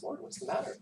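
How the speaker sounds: unvoiced question. Peter asks Jesus at dinner.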